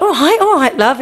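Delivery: high, hammed-up placating; mock the husband backing down. Husband calming his nagging wife, high mock placating. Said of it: "And he's like".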